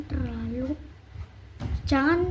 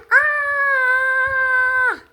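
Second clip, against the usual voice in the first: high, loud crying scream; acted scream; 10 semitones higher, 7 dB louder than usual. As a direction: wail out in sudden fright. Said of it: "Nah setelah kamu teriak".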